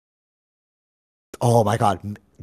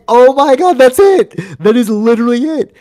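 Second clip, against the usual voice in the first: high, loud burst of discovery; excited realization; 9 dB louder than usual. Retold or imitated. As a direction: blurt it out like a eureka.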